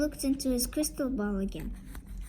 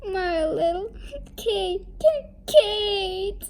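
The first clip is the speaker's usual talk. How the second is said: high, hammed-up sobbing wail; upset wail; 7 semitones higher, 5 dB louder, slower than usual. A narrator's reading. Mother mourns her bewitched daughter, mock sobbing. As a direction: sob between the words, building louder. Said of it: "Missus Crumb was very upset".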